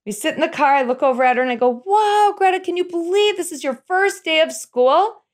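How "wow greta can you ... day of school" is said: high, bright-eyed excited question. Retold or imitated. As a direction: gush it to a little girl. Mom excitedly asks daughter.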